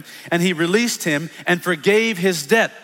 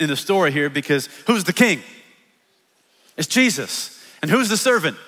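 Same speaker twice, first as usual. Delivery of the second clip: usual speaking voice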